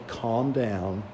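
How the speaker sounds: low, soft settling voice; soothing. Retold or imitated. Mature believer calming a younger one, soft and low.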